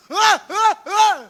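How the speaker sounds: loud, high bursts of roaring; three short wordless bellows; mock menacing yells. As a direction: yell like a blustering bully. Same devil yell, at bank account.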